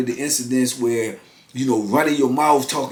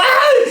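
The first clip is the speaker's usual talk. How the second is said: startled yelp; 5 dB louder than usual. Retold or imitated. Victim scared as Marty attacks.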